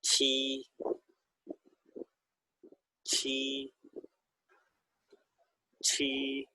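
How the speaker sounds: چھی with a breathy aspirated ch; aspirated چھی, repeated softly; Chinese q sound. Shown as a demonstration. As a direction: puff the air out on چھی. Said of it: "اس کو پڑھیں گے چھی".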